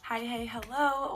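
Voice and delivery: ordinary talk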